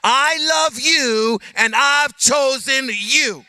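loud, high and slow, voice of God; God commanding the hurting to hear; preaching shout. Acted. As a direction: proclaim it at full force. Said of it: "And God says".

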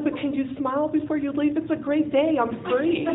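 beam at him and ask for a smile; elated tease. Retold or imitated.

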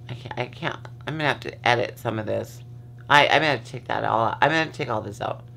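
fast, low muttered aside; rushed aside. Real, not acted. Telling herself she must edit, fast and low. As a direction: rattle it off to yourself.

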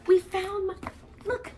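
high, breathless rush of finding her; excited discovery. Real, not acted. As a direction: burst out with delight.

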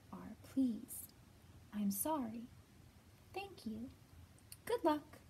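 expressive storybook reading voice; reading to children. Acted. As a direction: read it gently to little kids. Reads picture book to children.

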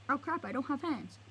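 usual speaking voice

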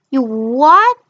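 loud, outraged disbelief; bully can't believe it; shocked. Acted.

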